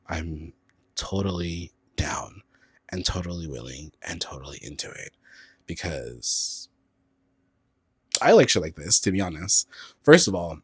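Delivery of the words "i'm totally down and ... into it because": breathy seductive whisper. Pretend. Teasing the straight coworker in a sultry whisper. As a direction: whisper it low like a secret offer. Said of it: "whispered come-on to coworker".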